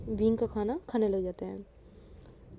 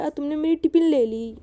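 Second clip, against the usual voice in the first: high, louder whining cry; crying complaint; 5 semitones higher, 5 dB louder than usual. Acted. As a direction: wail it like a wronged kid. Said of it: "ये बोलकर रोने लग जाता है". (Wing रोते हुए शिकायत करता है)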